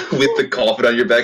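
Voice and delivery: laughing through the words; giggling description. Real, not acted. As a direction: chuckle while you say it. Laughing while describing scene.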